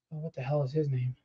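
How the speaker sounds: ordinary talk, nothing marked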